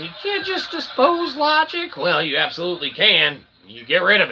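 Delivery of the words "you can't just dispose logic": much higher squeaky objector voice; mock objection. Host mimics a viewer objecting to him, high mock voice. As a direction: pipe up like a doubting viewer. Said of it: "you might be saying".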